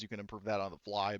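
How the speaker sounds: ordinary talk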